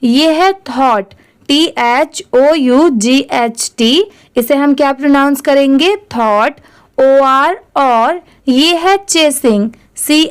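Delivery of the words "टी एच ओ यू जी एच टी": ordinary delivery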